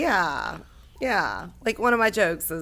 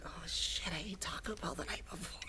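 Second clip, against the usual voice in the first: hushed panicked whisper; 2 semitones lower, 17 dB quieter than usual. Retold or imitated. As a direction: whisper it so he can't hear. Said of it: "I was like".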